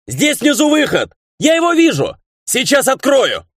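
very high, strained calling out; shout. A narrator's reading. Ray shouts to his friend about the way out, calling. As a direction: shout urgent news, pitch held right up high. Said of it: "закричал он".

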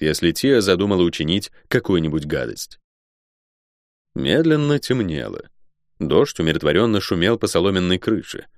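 plain reading voice

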